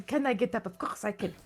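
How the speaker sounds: suave. Acted. Jean-Claude hides his struggle to stand, quietly suave. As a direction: play it cool, dropping softly on the answer. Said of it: "he's trying to appear suave".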